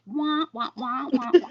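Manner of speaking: high muffled trombone wah wah; Charlie Brown adult. Retold or imitated. Teller mimics husband's news as Charlie Brown parents wah-wah. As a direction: mimic the teacher's wordless wah-wah. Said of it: "literally like Charlie Brown's parents".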